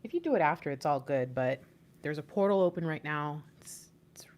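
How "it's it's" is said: breathy whispered aside, voice dropped. Real, not acted. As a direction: lean in and whisper it like a secret. Excited secret aside about portal.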